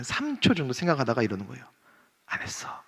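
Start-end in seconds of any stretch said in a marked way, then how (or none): 2.3-2.8 put-on child's sneaky whisper. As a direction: whisper it so mom outside can't hear. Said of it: "속삭이는 거예요". (속삭이는 아이 목소리)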